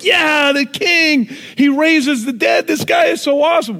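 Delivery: shouted high praise, loud and excited; praising crowd. Retold or imitated. Crowd cheering for Jesus, shouting with excitement. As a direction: cheer him on like a fan in the crowd. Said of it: "the people that are praising Jesus".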